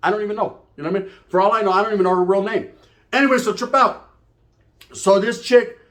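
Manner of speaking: ordinary talk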